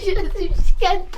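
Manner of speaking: words broken by loud laughter; can't stop laughing at the reveal. Real, not acted. Prank revealed, cracks up.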